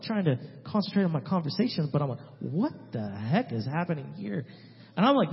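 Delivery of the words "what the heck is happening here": slow, drawn-out bewildered aside; baffled disbelief. Retold or imitated. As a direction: stretch it out in mock astonishment. Baffled reaction to hitting.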